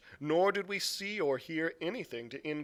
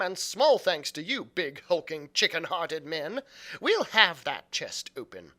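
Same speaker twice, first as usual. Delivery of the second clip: scornful, defiant mother; 3 semitones higher, 5 dB louder than usual. Acted. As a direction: lash them with contempt, voice raised. Mother scolds the frightened men.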